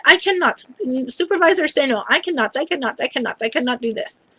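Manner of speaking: imitated flustered refusal, starting high; imitated lab technician. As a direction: mimic her refusing over and over. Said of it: "And then the gal that's supposed to be doing my blood".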